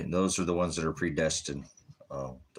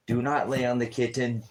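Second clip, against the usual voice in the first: higher, louder telling-off; scold; 6 semitones higher, 4 dB louder than usual. Real, not acted. Owner scolding the dog lying on the kitten, higher firm scold. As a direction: tell the dog off firmly.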